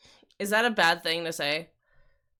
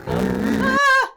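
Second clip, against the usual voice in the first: startled shriek; 6 dB louder than usual. Real, not acted. Startled by something on screen.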